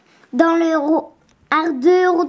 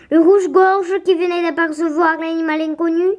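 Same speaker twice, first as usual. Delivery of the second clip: ordinary delivery, nothing marked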